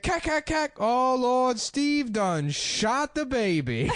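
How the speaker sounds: high then very slow lament; Steve Harvey impression. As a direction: gunfire then wail Oh Lord. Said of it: "and he's like".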